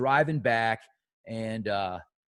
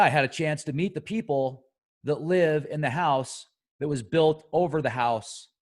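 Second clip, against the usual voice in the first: ordinary talk, nothing marked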